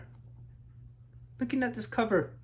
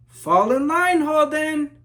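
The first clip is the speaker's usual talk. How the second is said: higher, louder sharp order; stern teacher call; 9 semitones higher, 13 dB louder, slower than usual. Acted. Teacher scolding the daydreaming Holden, loud stern call. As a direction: snap the boy back into line.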